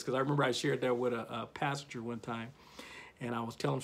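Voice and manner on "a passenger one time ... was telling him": ordinary talk, nothing marked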